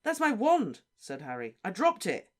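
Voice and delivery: high and loud; Harry claiming his wand; insistent. Acted. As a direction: insist urgently.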